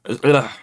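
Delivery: short, loud groan at a slip; frustrated ugh. Real, not acted. Reviewer botches his sentence about the album, frustrated groan. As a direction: cut yourself off with an exasperated ugh. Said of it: "Hold on, I said that wrong".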